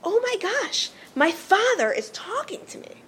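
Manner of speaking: high, lilting astonished realization; mildly hammed-up awe, pitch leaping up; amazed son. Moshe realizes his father is talking to him, amazed. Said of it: "a tone that Moshe intuitively understood was".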